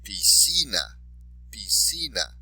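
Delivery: "Piscina" with CI stressed; stress on next-to-last syllable. Shown as a demonstration. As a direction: model "piscina" twice, leaning on CI. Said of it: "stress the underlined syllable".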